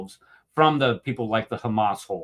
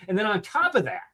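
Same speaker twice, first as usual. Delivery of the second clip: ordinary delivery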